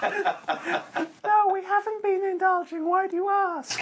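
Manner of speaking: very high squeaky voice through laughter; giggly helium-pitched denial. Pretend. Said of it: "really high-pitched squeaky voices".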